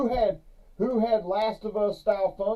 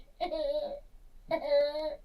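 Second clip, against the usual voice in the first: high, wheezy hee-haw laughter; mock hysterical donkey cackle; imitated donkey laugh; 10 semitones higher than usual. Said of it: "That donkey starts".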